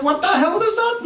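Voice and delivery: high, fed-up demand; exasperated outburst. Retold or imitated. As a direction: snap back in disbelief. Exasperated reply to ticket kid.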